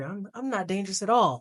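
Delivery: a hint of big-brother reassurance; brotherly voice. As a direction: play the innocent brother, lightly. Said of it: "in the most brotherly voice ever".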